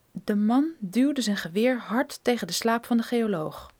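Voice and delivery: usual speaking voice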